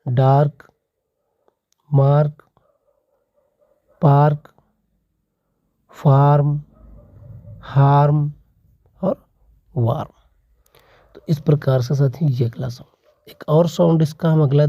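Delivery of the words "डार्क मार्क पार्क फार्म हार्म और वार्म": डार्क, मार्क, पार्क with a long broad aa, each word separate; example words read out. Shown as a demonstration. Teacher reading the A-before-R examples, clearly articulated. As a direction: read डार्क मार्क पार्क फार्म हार्म वार्म as a drill. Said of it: "जैसे निकल के आया है आपका".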